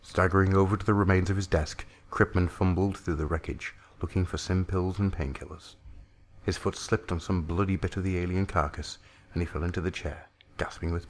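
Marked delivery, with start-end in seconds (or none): none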